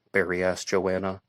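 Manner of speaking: ordinary delivery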